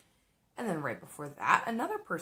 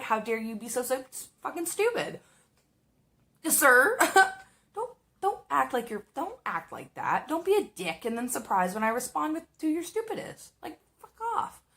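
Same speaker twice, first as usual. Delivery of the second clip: mock furious rant; 7 semitones higher, 4 dB louder than usual. Telling off a sexist customer in a rising, high-pitched rant. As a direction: pitch climbing into a high indignant yell. Imagined outburst at rude customer.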